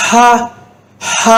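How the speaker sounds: ஹ pushed out with only air; ஹ as a short airy ha. Shown as a demonstration. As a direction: breathe out the h of ஹ. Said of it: "காற்று மட்டும்தான்".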